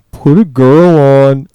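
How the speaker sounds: very loud, drawn-out demand; imitated slow holler; mock demanding shout. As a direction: bellow it slowly like an obnoxious prank caller. Prank caller's punchline demand.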